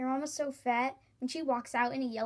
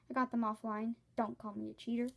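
normal talking voice

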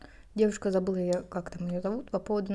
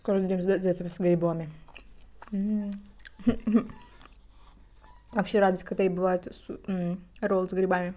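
muffled words between bites; talking while chewing. Real, not acted. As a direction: mouth full of food. Seen 2: Roll put in mouth at -3; mouth full, chewing while talking.

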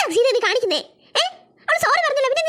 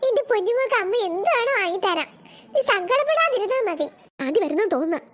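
ordinary talk, nothing marked

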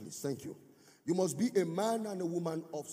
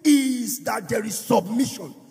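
louder, higher, sung-out preaching over the music; preaching at full force; 6 semitones higher, 8 dB louder than usual. Acted. Pastor preaching to the congregation, in full sung-out force. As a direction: lift the church with a chanted, rising sermon.

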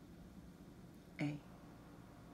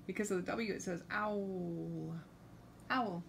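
plain reading voice

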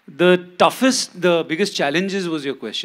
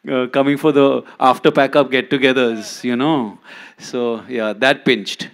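normal talking voice

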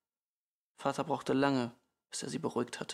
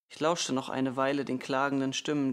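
ordinary delivery

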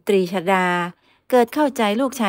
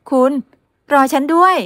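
higher, louder call of wait for me; girl calling after the man who leaves her behind; 7 semitones higher, 6 dB louder than usual. Acted. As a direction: call after him as he walks off.

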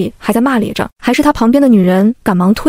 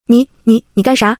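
ordinary delivery, nothing marked

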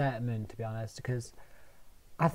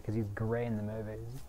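ordinary talk

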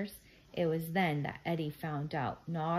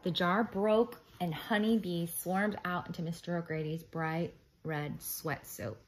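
ordinary delivery

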